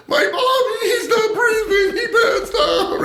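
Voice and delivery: very high, drawn-out fake wail; hammed-up crying panic. Faking distress to firemen over his partner, hammed-up wail. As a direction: wail it high, laughter breaking through. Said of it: "they think I'm crying".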